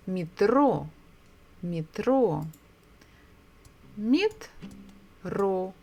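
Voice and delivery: "metro" with the stress on "tro"; stress on the second syllable. Shown as a demonstration. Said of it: "So we stress the second syllable".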